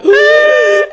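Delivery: loud, high-pitched thrilled squeal; excited gasp. Retold or imitated. Customer's heart leaping at the innuendo, loud high squeal. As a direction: squeal loudly as if lighting up inside.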